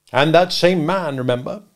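weighty courtroom warning; denouncing lawyer. Acted. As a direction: build the accusation deliberately. Stranger continues denouncing Wopsle.

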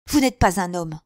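loud, scornful insult; angry reproach. A narrator's reading. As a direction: throw it in his face. Queen scornfully insults Mazarin.

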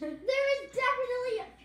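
loud, grossed-out insistence; disgusted shout. Real, not acted. Tasting dirt bean.

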